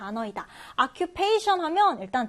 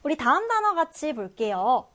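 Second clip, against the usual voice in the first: ordinary talk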